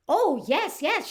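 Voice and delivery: high, loud, swooping "oh, yes"; emphatic recognition. Retold or imitated. Manager recognises woman, acted reply.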